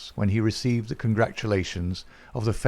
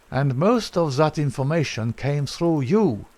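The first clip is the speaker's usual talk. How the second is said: anguished cry; 6 semitones higher than usual. A narrator's reading. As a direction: burst out in bitter realization. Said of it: "he cried".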